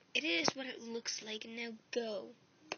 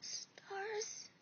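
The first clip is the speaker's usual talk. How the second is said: shocked; 7 semitones higher, 3 dB quieter, much slower than usual. Acted. Shocked hushed gasp.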